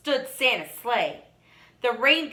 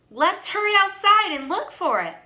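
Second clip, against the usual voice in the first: put-on urgent child; 7 semitones higher, 4 dB louder than usual. A narrator's reading. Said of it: "one of the".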